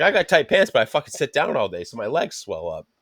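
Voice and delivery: usual speaking voice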